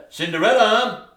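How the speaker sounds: loud call across the house. Acted. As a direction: summon her from another room. Calling her from afar.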